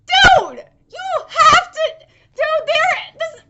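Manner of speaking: high, loud, spluttering excitement; excited disbelief. Real, not acted. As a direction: burst out, too worked up to finish. Overwhelmed by the coincidence.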